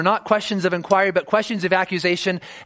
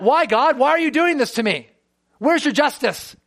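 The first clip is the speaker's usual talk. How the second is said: higher, pointed demanding questions; accusatory; 8 semitones higher than usual. Shown as a demonstration. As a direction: shake your fist at God as you ask. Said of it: "You can say it in an accusatory manner".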